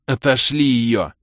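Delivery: slow, insistent command; urgent order. A narrator's reading. Hero tells elf to send her away, insistent. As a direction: press the order firmly.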